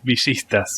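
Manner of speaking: "villistas" with sh for the double L; "villistas" sounded like bee-SHEES-tas; Argentine pronunciation. Shown as a demonstration. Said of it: "we pronounce the double L".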